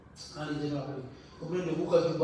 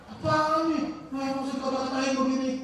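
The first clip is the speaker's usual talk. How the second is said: higher, louder, indignant mock retort; 7 semitones higher, 3 dB louder than usual. Preacher voicing an offended listener, indignant. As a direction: bristle back at the insult. Angry reply to gossip.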